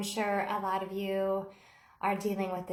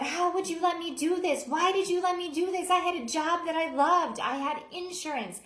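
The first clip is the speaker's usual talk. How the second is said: yelling; 6 semitones higher than usual. Retold or imitated. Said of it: "she starts yelling at Michael".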